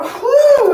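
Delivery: a high, loud whew of relief; relieved celebratory exhale. Real, not acted. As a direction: blow out a happy whew. Relief after last touch.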